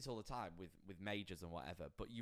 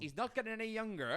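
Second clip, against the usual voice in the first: put-on high, loud Welsh accent; sing-song Welsh accent; 12 semitones higher, 8 dB louder than usual. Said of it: "I'm not gonna do the Welsh accent".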